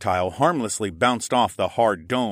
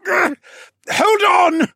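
high, loud shout of effort; grunt, then yell while gripping hard; strained urgent cry; straining; 8 dB louder, much slower than usual. Acted. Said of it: "Horace strained".

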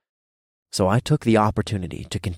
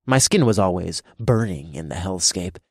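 usual speaking voice

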